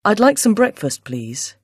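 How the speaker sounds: ordinary talk, nothing marked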